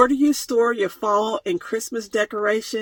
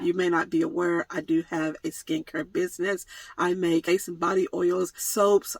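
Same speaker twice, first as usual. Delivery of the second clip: usual speaking voice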